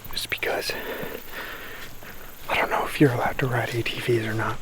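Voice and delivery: hushed hunter's whisper. Real, not acted. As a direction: whisper so the deer won't hear. Whispering near deer in woods.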